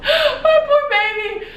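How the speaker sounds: slow, very high, teary cooing; tender pity. Real, not acted. Mother fondly pities her dry newborn, slow high crying coo. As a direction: coo over the poor little thing.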